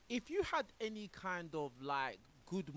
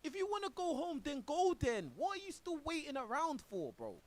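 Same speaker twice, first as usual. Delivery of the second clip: high, raised-voice challenge; exasperated outburst; 9 semitones higher, 3 dB louder than usual. Real, not acted. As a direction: burst out at someone who won't leave.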